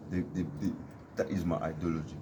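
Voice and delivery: usual speaking voice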